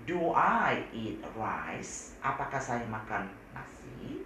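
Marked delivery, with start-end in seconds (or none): 0.1-2.1 very slow, careful English; slow model sentence. Shown as a demonstration. Teacher slowly models the question. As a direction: spell out the example slowly for students. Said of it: "dan I".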